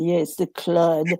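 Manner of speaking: Claude in a mock French accent, slow. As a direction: say it like a playful Frenchman, drawn out. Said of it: "I like to say it in the French accent".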